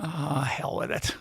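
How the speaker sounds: soft, slow muttered thought; half-whispered aside to yourself; thinking to himself. Retold or imitated. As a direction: say it under your breath, resigned. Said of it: "I'm thinking".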